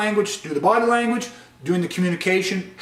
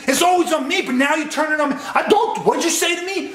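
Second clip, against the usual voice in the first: escalating shouting; 8 semitones higher than usual. Shown as a demonstration. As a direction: shout it, spiralling out of control. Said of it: "you can see the deterioration start to happen".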